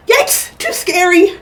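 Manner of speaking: high mock fright on Yikes; a light startled cry; cried. A narrator's reading. Goldie jolting off the folding bed, mildly scared. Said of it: "she cried".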